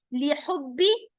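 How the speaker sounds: usual speaking voice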